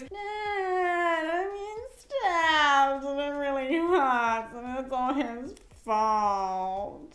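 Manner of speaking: mock crybaby voice tailing off; whining child. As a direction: sob like a spoiled kid tattling. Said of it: "whine at me".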